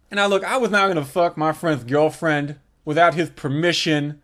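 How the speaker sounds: ordinary talk, nothing marked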